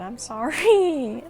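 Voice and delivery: loud, drawn-out mock wail of apology; over-the-top, elongated sorry; exaggerated apology. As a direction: stretch the apology out high and loud, hammed up. Mock-wailed apology for past deed.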